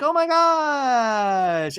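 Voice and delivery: loud, high, long drawn-out squeal of a reaction; mock group gushing. Everybody hears the girlfriend news, exaggerated high gushing. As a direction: stretch it out like a whole crowd gushing.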